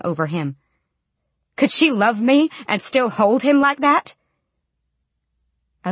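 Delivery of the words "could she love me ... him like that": high, loud, rising question; anguished outrage. A narrator's reading. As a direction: demand an answer in hurt disbelief. Wounded child's enraged inner question.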